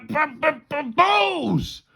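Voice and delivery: high, appalled stammered outburst; struggling stammer. A narrator's reading. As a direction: stutter that bursts into a shout. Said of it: "could barely bring himself to say".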